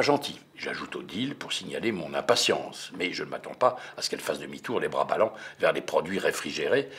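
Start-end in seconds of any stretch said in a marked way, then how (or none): none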